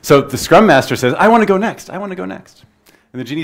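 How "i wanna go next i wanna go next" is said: higher-pitched, eager to be picked; mock-eager plea in a raised voice; eager pleading. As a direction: jump in like a keen volunteer. Eager Scrum Master begs the genie.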